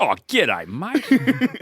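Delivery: put-on a thick outback Australian accent; broad Aussie. Crocodile Dundee greeting a New York cop in broad Aussie. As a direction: lay on the ocker drawl. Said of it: "the accent is".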